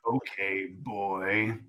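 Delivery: soft, stoic hero voice; cool Batman. Acted. Batman accepts the leader's challenge, quiet and cool. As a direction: answer quietly as an unfazed Batman.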